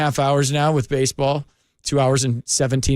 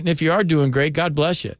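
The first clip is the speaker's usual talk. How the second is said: ordinary talk, nothing marked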